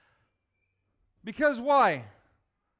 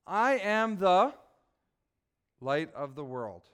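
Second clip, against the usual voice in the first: slow recitation; 4 semitones lower, slower than usual. Shown as a demonstration. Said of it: "Can you say that with me?".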